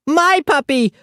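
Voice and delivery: put-on high toddler scream. A narrator's reading. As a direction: scream it like a tantrum. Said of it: "Aki screamed".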